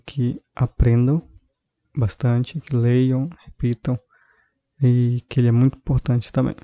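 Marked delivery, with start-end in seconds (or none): none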